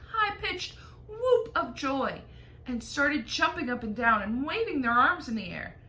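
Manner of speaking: ordinary delivery, nothing marked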